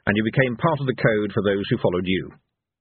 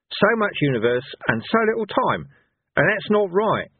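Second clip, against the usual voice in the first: higher-voiced old barbarian, earnest protest; Cohen; 6 semitones higher than usual. A narrator's reading. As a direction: argue it as an unschooled old warrior. Cohen explains the heroes' grievance.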